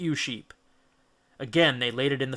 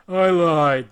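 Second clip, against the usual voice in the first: louder, gleeful giant boast; beaming; 4 semitones higher, 9 dB louder than usual. A narrator's reading. Polyphemus gloating to Odysseus, beaming. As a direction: gloat with a grin. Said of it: "with a beaming smile".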